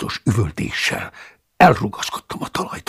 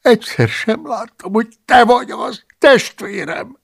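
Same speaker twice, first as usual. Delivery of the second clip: much higher, pleading monk voice; apologetic excuse; 11 semitones higher, 4 dB louder than usual. Acted. The cellarius excusing himself to Paulus, pleading. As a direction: make excuses, whining.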